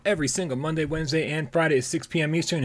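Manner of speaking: high, bright show-opening announcer read. Acted. Host opening the live gaming show, announcer read.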